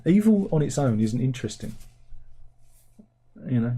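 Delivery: normal talking voice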